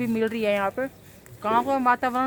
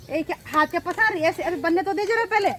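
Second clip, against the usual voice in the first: higher, louder teasing scold; playful scolding; 7 semitones higher, 4 dB louder than usual. Real, not acted. Scolding girl for grabbing food.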